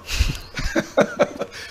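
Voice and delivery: a quick airy burst of laughter; high breathy laugh. Real, not acted. Guest laughing at his own point on air. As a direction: laugh it off lightly.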